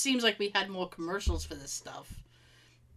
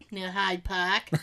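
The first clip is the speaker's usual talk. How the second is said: put-on Boston accent; 2 semitones lower, slower than usual. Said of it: "stretch out our accents".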